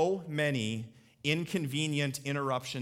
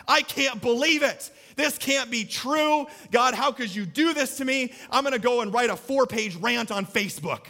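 higher, louder, hammed-up outrage; mock outraged Mary; 8 semitones higher, 6 dB louder than usual. Pastor imagining Mary complaining to God, hammed-up high outrage. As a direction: whine it like a furious teen. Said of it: "Mary just went".

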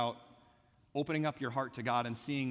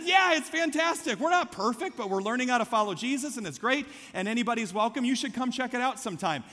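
high, louder, upbeat retold invitation; enthusiastic invitation; 8 semitones higher, 5 dB louder than usual. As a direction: talk up the church eagerly. Retells his upbeat church invitation.

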